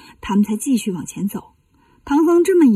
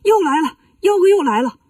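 higher-pitched alarm call; mild shout; 5 semitones higher than usual. Acted. 悟空 warns his companions that the demon is back, mild shout. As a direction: call out a warning, only mildly shouted. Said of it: "喊".

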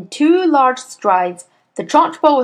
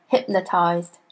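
ordinary delivery, nothing marked

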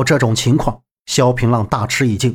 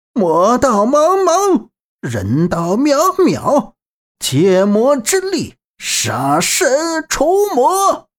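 slow, high, drawn-out chant-like shout; shouting to the sky; 9 semitones higher, much slower than usual. A narrator's reading. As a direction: bellow each phrase slowly to the heavens. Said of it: "仰天大喊道".

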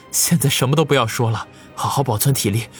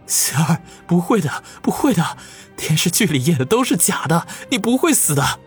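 higher, tearful denial; choked up; 9 semitones higher than usual. Acted. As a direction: hold back sobs. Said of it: "哽咽地说道".

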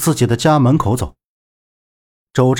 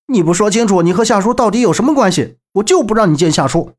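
higher, heated, insistent challenge; defiant; 6 semitones higher, faster than usual. A narrator's reading. Young man barring visitor from the uncle, high and defiant. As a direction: stand your ground and demand answers.

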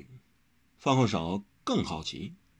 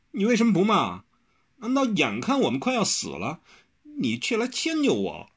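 高声委屈地连连质问; 急得跺脚，又委屈又气; whining stamping protest; 11 semitones higher, 4 dB louder than usual. A narrator's reading. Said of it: "方汉少跺着脚道". (跺着脚委屈地嚷)

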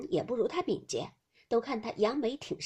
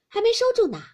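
high, loud exclamation; calling out; 12 semitones higher, 8 dB louder, much faster than usual. A narrator's reading. Tanchun pointing out to everyone that the poem is unfinished, calling out. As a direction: call it out across the group.

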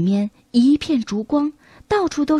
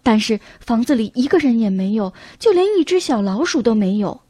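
usual speaking voice

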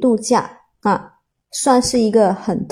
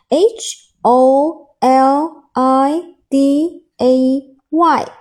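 letter by letter, very slowly; slow dictation of single letters; slow spelling; 3 dB louder, much slower than usual. Shown as a demonstration. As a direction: spell it out slowly, each letter held apart. Spelling the word to memorise it.

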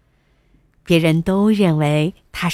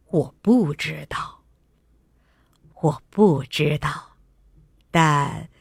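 king; 4 semitones lower than usual. A narrator's reading. King gently answers his daughter.

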